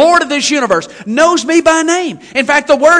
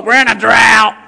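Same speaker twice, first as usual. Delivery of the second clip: hammed-up grumpy growl; mock grouchy naysayer; sour complainer; 3 semitones lower, 5 dB louder than usual. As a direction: cut down the cheer with a loud grumble. Mimics sour church complainer.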